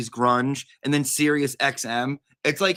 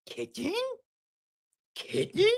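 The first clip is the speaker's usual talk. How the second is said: soft cat call; 8 dB quieter than usual. Real, not acted. Calling to the cat.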